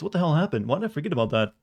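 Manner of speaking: ordinary talk, nothing marked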